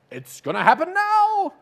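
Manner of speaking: very high, loud, drawn-out cry; thrilled anticipation. Acted. Elijah certain God will act now, a high slow excited cry. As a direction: shout it as if the miracle is arriving. Elijah expecting God's power.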